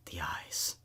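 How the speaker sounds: breathy eerie whisper; hushed spooky repeat. A narrator's reading. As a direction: whisper it like a secret horror.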